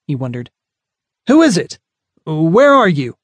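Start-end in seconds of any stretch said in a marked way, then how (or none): 1.3-3.2 loud, high call into the dark; calling out with concern. Acted. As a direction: call out, worried but unafraid. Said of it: "His baritone voice was thick with concern".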